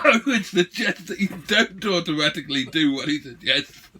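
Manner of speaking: loud, laughing through the words. Real, not acted. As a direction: hold back giggles while talking. Said while laughing.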